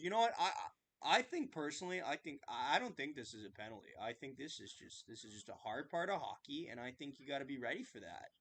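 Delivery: low, soft, fast pundit voice, calmly excusing the hit; Kevin Bieksa impression. Fan mocking a hockey analyst defending a hit, low and fast. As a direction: mumble it like a laid-back TV analyst. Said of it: "a damn good Kevin Bieksa impression".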